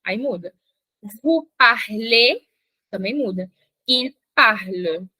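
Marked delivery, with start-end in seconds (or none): 1.2-2.4 "Tu parles, il parle" spoken very slowly and clearly; careful teacher model; slow conjugation demo. Shown as a demonstration. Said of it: "Repete comigo". 3.9-5.1 "Tu parles, il parle" spoken very slowly and clearly; careful teacher model; slow conjugation demo. Shown as a demonstration. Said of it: "Repete comigo".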